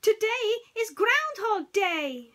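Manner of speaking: high, loud, slow excited shout; two friends yelling it out at dawn; joyful shout. A narrator's reading. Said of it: "shouted Pooh and Piglet together".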